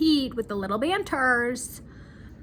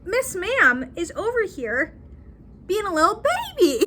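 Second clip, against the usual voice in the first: mocking; 8 semitones higher, 3 dB louder than usual. Real, not acted. Mocking Joan as a baby.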